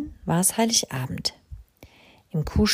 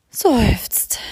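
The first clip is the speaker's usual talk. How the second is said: sigh; 5 semitones higher, 4 dB louder than usual. Acted. Crow in bed, cold and dark.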